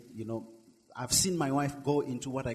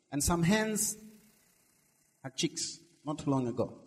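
ordinary delivery

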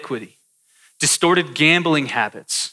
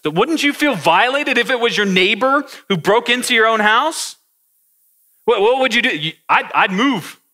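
higher, louder, hurt indignation; indignant outrage; 8 semitones higher, 4 dB louder than usual. Pretend. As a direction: preach it with wounded outrage. Outraged at betraying neighbor.

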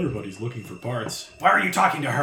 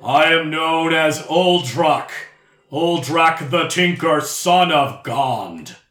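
slow, loud, rumbling proclamation; rumbling booming voice; 4 semitones higher, 7 dB louder, much slower than usual. Acted. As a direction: boom it out slowly from inside a helmet. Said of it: "it rumbles throughout the helmet".

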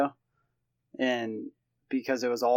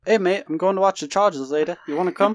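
mock Australian accent, lightly put on; a bloke's Australian accent, hammed up; 5 semitones higher, 4 dB louder, faster than usual. Podcast host offering a friend Chargers tickets in a mock Australian accent. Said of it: "That's Australian".

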